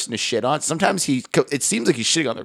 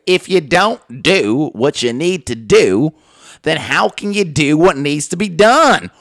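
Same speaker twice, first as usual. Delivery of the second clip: slow, loud, weighty cadence; raised mock TV counselor pronouncement; Doctor Phil; 4 semitones higher, 6 dB louder, slower than usual. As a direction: build obvious advice to a grand finish. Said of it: "the cadence of Doctor Phil".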